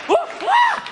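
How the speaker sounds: high squeaky scared whoas; mock the frightened whimper. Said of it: "you think I'm not up here thinking".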